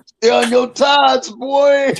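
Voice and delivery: drawn-out teasing holler at a cellmate; yelled call. Retold or imitated. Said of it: "I used to yell around the chair".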